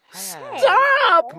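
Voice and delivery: high sharp yell. A narrator's reading. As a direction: shout it to make him stop. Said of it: "my sister yelled".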